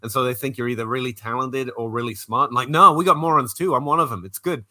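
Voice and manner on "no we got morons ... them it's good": louder, emphatic jokey denial; mock denial. Englishman tells Americans he's one of the morons, loud mock denial. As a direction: insist with a grin that morons exist too. Denying English are all smart.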